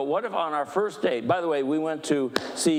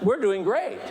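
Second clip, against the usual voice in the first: higher and louder through a laugh; 7 semitones higher, 3 dB louder than usual. Shown as a demonstration. Preacher laughing off running late. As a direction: laugh it off as a cheery fib. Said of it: "I'm not gonna tell you that".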